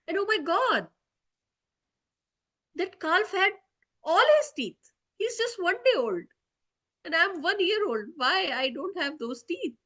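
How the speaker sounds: put-on a little boy bursting with amazement; excited child. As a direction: gasp over the calf's teeth as a wronged child. Child Krishna exclaims about calf teeth.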